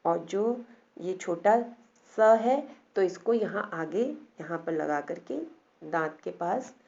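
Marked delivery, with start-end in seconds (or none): none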